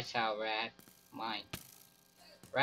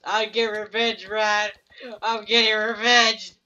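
higher, raised, relishing threat; mock vengeful vow; 6 semitones higher, 3 dB louder than usual. Gamer vowing revenge on Rad, hammed-up vengeful tone. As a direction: gloat over the revenge.

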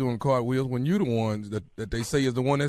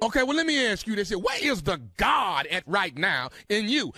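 higher, shouted challenge; challenging; 7 semitones higher than usual. Acted. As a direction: demand an answer. Caller challenging her faith.

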